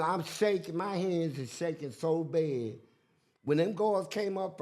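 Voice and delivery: ordinary talk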